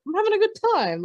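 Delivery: high-pitched goofy imitation of a guy; mock carefree partygoer. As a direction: play him insisting he's having fun. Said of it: "with the cigarette hanging out of his mouth".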